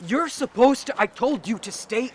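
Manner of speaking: loud, high outburst of alarm; angry scolding. Acted. Scolding someone who came out against orders, angry. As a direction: shout it, cutting yourself off.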